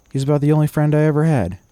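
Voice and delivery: high, loud, fast protest; heated protest. Acted. Boy defending his horse to Masters, heated protest. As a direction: defend your friend indignantly, pitched right up.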